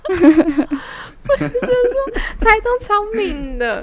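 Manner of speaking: high, loud, slow half-laughing wail; mock wail. Griping to friends that Taitung is cursed, mock wail. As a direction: stretch it out like a comic lament. Jokingly complaining about Taitung.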